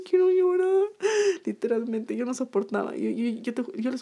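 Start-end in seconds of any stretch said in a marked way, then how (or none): 0.1-0.8 high, loud whimpering wail; mock crying whine, voice pitched up; whiny near-tears. At the vet watching her dog cry, high whiny wail. Vet takes dog's temperature.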